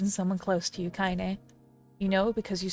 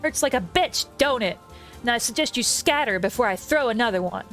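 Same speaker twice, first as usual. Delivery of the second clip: put-on loud, higher, furious old woman; thick with rage; 3 semitones higher, 4 dB louder than usual. Old woman threatening the bullies, loud and furious. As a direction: threaten them with raw fury. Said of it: "in a voice thick with rage".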